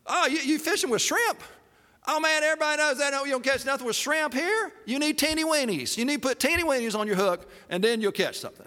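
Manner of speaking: high-pitched unsolicited fishing advice; know-it-all bystander. Acted. Onlooker tells someone what they're doing wrong, know-it-all.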